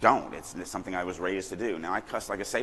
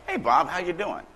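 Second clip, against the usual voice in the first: higher, lively easy greeting; natural-sounding greeting; 8 semitones higher, 5 dB louder than usual. Shown as a demonstration. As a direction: make it feel real and effortless. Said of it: "That's what it has to feel like".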